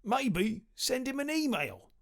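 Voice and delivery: a lightly voiced messenger being smart; cheeky messenger voice. A narrator's reading. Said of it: "said the messenger".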